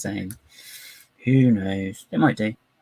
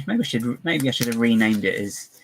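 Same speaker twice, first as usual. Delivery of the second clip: ordinary talk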